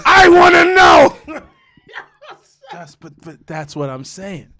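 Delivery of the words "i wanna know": slow, loud high demand. Retold or imitated. Boyfriend demanding answers, slow loud shout. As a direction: drag it out, shouting.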